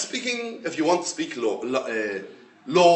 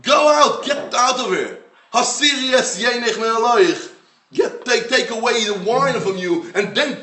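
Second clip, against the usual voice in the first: much higher and louder, shouting her out; angry expulsion; 6 semitones higher, 4 dB louder than usual. Retold or imitated. Priest drives the praying woman out, angry raised shout. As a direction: throw her out of the sanctuary. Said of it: "what Eli HaKohen tells her".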